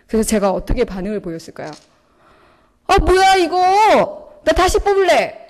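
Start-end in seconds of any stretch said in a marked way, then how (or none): 2.9-5.5 high, loud, slow sulky whine; hammed-up huffy grumble; petulant complaint. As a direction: whine like a kid demanding a redraw. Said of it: "이러면서".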